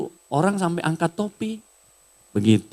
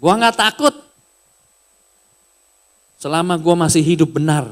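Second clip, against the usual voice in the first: louder, firm and fearless; quoting the governor; 2 semitones higher, 4 dB louder than usual. Retold or imitated. Governor declares he is unafraid, loud and firm. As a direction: declare it without fear. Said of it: "Dia kan kalau ngomong lu gua".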